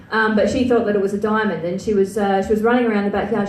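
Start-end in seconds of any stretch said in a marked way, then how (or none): none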